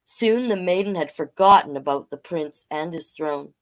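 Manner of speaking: ordinary delivery, nothing marked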